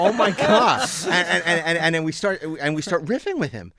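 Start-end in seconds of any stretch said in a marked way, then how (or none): 0.0-0.9 laughing. Retold or imitated. Laughing at Shatner's answer.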